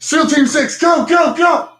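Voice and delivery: high, loud hammed-up military order; joking shout for the troops to storm in; mock command shout. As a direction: bark it like a raid commander, in jest. Mock calling in snipers.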